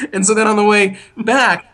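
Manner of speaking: ordinary talk, nothing marked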